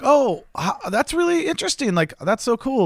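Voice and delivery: high-pitched, overly polite enthusiasm; put-on interest. Acting polite interest to the escort.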